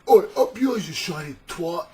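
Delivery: loud, high Cockney jeer; hammed-up Cockney heckling, high and loud; Cockney jailer. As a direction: hurl insults like a rowdy Cockney. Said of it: "the jailers would just be like".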